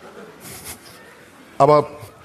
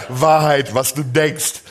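usual speaking voice

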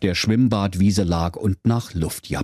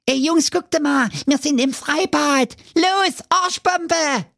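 put-on very high cheeky kid voice; excited dwarf; slower than usual. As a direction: hype the cannonball.

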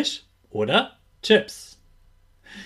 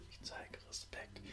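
soft whispered repeat; whispered echo; 29 dB quieter than usual. Shown as a demonstration. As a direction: whisper it back. Repeat-after-me affirmations.

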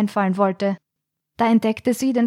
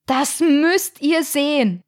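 higher, slow voice calling from across the room; distant call; 7 semitones higher, slower than usual. A narrator's reading. Verena calling her friends over from across the room. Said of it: "erklang Verenas Stimme von der anderen Seite des Raumes".

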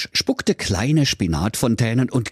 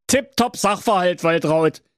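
high, jubilant husband voice; cheering; 9 semitones higher than usual. Acted. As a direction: whoop it like he's won. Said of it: "jauchzte der Werner". (hoch und jauchzend)

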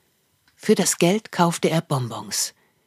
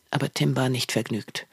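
ordinary delivery

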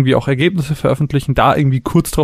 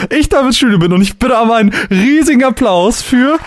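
slow, higher showman announcement; announcer voice; 9 semitones higher, 3 dB louder, slower than usual. Acted. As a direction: hype up the guest's entrance. Announcing guest with applause.

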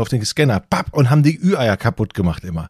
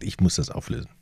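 whispered aside; 5 semitones lower, 9 dB quieter, faster than usual. Real, not acted. Muttering aside during quiz.

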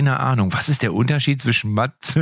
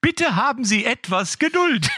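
phone menu voice; 9 semitones higher than usual. Retold or imitated. Mocking a phone hotline queue.

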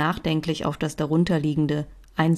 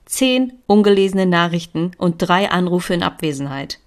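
plain reading voice

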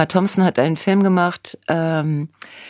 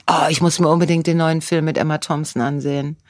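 normal talking voice

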